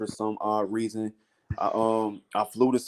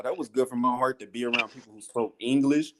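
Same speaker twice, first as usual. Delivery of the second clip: ordinary talk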